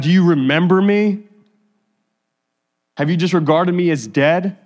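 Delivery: loud, emphatic plea rising into questions; anguished confronting of God. Acted. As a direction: demand answers from God in lament. Acts out psalmist confronting God.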